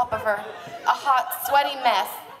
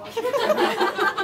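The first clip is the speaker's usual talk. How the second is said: high giggle; giggling at the text; laughing; 10 semitones higher than usual. Real, not acted. Reader laughs again.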